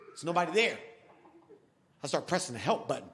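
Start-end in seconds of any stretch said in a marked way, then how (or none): none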